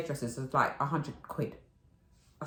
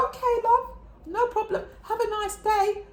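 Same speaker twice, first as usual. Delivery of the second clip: much higher, louder, syrupy reply; sugary sweet; 5 dB louder than usual. Retold or imitated. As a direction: kill him with kindness. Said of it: "I said".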